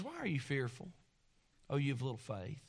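gentle Jesus. Acted.

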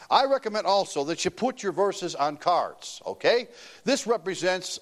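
ordinary delivery

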